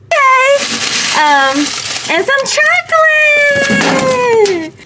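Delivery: high, loud and drawn-out; delighted squeal. Real, not acted. Nurse finding chocolate in her gift, delighted squeal. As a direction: stretch it in pure delight.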